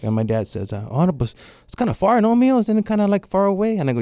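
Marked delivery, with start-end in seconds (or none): 0.9-3.8 loud, high-pitched fretting father; hammed-up parental worry; imitating a worried dad. Voicing dad's worry over distance.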